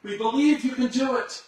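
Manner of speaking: high, loud crowd reply; crowd answering. Retold or imitated. Crowd answering the tightrope walker, high and loud. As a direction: call back as the believing crowd. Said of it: "they said".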